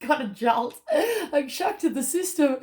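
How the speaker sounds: high, laughing jolt of surprise; startled laugh as the shock hits. Real, not acted. Reader jolted mid-reading, laughing in shock. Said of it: "oh, that was a shock to the system".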